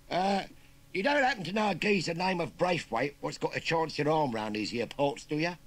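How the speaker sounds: high, loud cheeky Cockney lad; chirpy London street kid rattling off slang; perky Cockney urchin. Acted. Urchin asks farmer about the job in Cockney.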